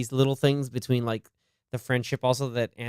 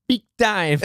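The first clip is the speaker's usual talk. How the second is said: high, punchy fake Jamaican accent; mock Jamaican accent; 7 semitones higher, 4 dB louder than usual. Quoting the movie's bad impression, hammed-up Jamaican accent. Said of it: "How would you do Jamaica?".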